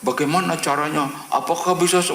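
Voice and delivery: high, insistent string of questions; interrogating questions. Retold or imitated. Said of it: "nanyanya kayak diinterogasi".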